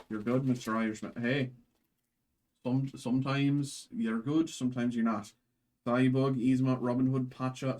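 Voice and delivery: usual speaking voice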